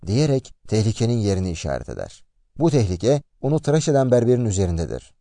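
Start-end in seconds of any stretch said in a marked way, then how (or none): none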